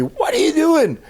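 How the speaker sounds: very high-pitched shriek of a scandalized nurse; mock scream. Nurse walking in on the patient, mock screaming. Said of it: "she screams at me".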